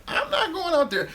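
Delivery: squeaky, sky-high refusal; hammed-up high-pitched no way; mock incredulous refusal. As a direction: toss it off in an outraged falsetto. Refusing the birthday trip.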